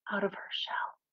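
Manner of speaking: breathy storytelling whisper; hushed whisper; whispered softly. Acted. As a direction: whisper it like a secret to the kids. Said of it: "She whispered softly".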